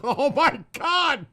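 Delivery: high, loud laughing outburst; laughing hard. Real, not acted. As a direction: crack up mid-sentence, voice shooting up. Bursts laughing at misheard word.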